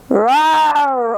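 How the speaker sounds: a loud, angry bear roar. Acted. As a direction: roar like a sore loser bear. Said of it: "Old Bear roared".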